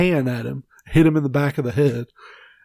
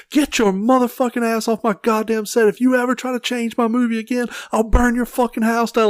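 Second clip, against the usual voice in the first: higher mock rage at the producer; flipping out; 8 semitones higher than usual. Director threatening a meddling producer, in a hammed-up rant. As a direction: tear into him like a furious director. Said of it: "just flip the fuck out on him".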